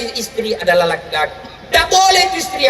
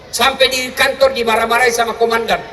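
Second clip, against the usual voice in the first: normal talking voice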